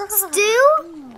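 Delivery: high, disgusted mock; disgusted snort. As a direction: sneer the word in disgust. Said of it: "snorted out loud in disgust".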